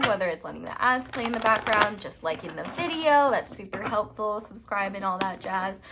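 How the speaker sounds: ordinary talk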